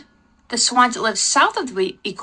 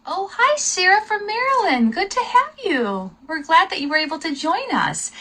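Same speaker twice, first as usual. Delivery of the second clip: cheerful greeting; 8 semitones higher than usual. Real, not acted. Greeting a live viewer.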